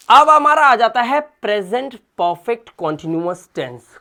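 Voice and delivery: normal talking voice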